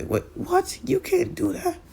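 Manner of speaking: very high squeaky disbelief; shocked protest. Acted. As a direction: squeal it like stunned kids.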